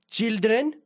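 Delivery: put-on old Greek man, high and drawn out; imitating an old Greek bishop; Greek accent. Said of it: "this is in a Greek accent".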